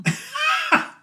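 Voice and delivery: a quick self-mocking laugh; a brief rueful chuckle; short laugh. Real, not acted. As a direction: laugh at your own mistake. Laughs at own bad idea.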